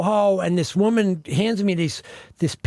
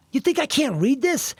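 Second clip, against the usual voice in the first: high, offended retort, retold; indignant disbelief; 8 semitones higher than usual. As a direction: replay the insulted challenge, pitched up. Retells indignant reply at audition.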